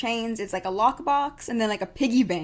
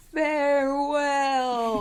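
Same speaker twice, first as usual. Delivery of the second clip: very slow, drawn-out ghostly farewell; 4 semitones higher, much slower than usual. Retold or imitated. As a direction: draw it out like a haunting ghost. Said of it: "he goes again".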